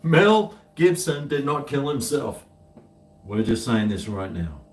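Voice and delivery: ordinary delivery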